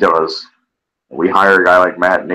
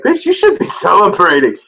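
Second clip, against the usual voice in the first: laughing retort; 12 semitones higher than usual. Retold or imitated. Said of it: "I'm like".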